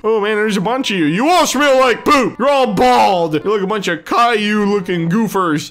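usual speaking voice

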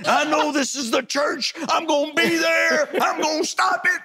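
very high shrieking mock threat; crazed fan. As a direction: scream the threat like a stalker fan. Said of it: "And I would put the date".

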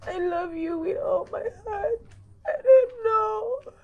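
loud sobbing cry; loud tearful outburst; anguished crying. Acted. Mother cries out to son.

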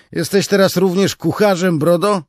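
high, loud teasing soldier; mocking question. Tall soldier mocking Beard's boasts, high loud tease. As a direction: needle the braggart.

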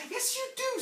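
put-on high little-girl insistence; insistent child. Child Jane insists to Wendy.

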